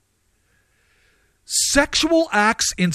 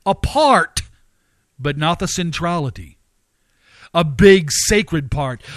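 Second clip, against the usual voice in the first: ordinary talk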